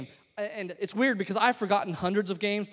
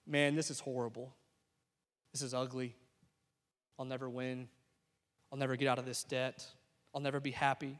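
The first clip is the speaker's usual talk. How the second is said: low, soft, spaced-out discouragement; hopeless; 7 semitones lower, 11 dB quieter than usual. Retold or imitated. Churchgoer looking back on life, hopeless. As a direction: sink quietly into resigned despair. Voicing listeners' despairing thoughts.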